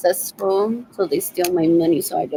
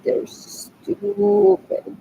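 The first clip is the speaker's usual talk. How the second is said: much higher, very slow "They're stupid", "stupid" stretched long; high mocking drawl; 12 semitones higher, 3 dB louder, much slower than usual. Real, not acted. Repeating insult about cousins.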